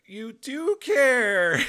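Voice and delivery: a joyful cry of relief as it sinks in; overjoyed realization. Real, not acted. Realizing God's love, overwhelmed.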